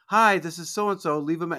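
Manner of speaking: bright greeting on the answering machine; sweet giggly wife. Retold or imitated. Voicing the late wife's answering machine message, sweet and giggly. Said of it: "she's all sweet and giggly".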